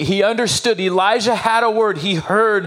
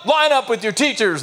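daddy voice; 8 semitones higher than usual. Retold or imitated. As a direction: call the kids to order like Dad. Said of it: "because of the daddy voice".

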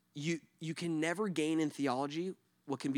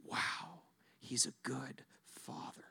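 soft, slow, awed whisper; 3 semitones lower, 11 dB quieter, slower than usual. Real, not acted. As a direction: breathe it out in wonder. Awed quote closing the point.

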